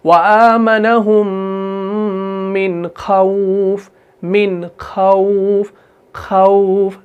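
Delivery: slow chant, long "au" in खौफ; drawn-out खौफ on a level tone; soft leen recitation. Shown as a demonstration. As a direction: hold the hum of आमनहुम, then glide gently through खौफ. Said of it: "नरमी से अदा कर रहे हैं इसको".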